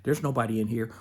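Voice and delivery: soft, secretive shushing inner voice; whispered shh, then soft soothing denial; hushing whisper. Retold or imitated. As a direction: hush them like a sneaky voice in your head. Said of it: "or it'll say".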